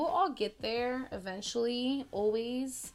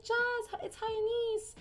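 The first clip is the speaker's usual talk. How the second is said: high, bright mock-call to Jazz; friend calling out; 13 semitones higher, 3 dB louder than usual. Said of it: "She's like".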